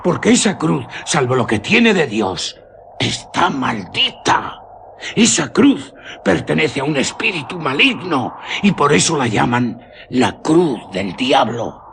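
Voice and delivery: a superstitious guide scolding the doubting traveller; old village guide. Acted. Guide warning the traveller the cross is cursed, high ominous voice.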